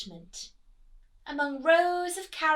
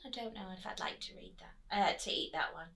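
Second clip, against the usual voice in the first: whispered aside; 6 semitones lower, 9 dB quieter, much faster than usual. Real, not acted. Teacher whispering to the class, in a quick aside. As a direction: let them in on a secret, fast.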